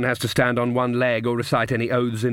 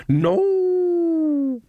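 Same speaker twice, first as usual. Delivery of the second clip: shrill cry. Acted. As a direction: shout to stop the blow. Sudden cry at the wolf.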